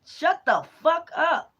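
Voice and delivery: high, slow, menacing growl of the deity; hostile spirit voice. Retold or imitated. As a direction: voice the angry spirit stretching each word. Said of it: "That shit look crazy as hell".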